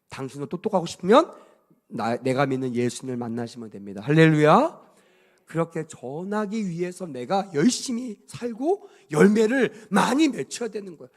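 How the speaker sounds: ordinary delivery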